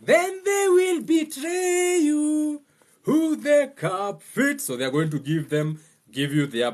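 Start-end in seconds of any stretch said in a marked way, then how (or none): 0.0-4.6 high, slow mocking falsetto. Mocking voice of betrayers.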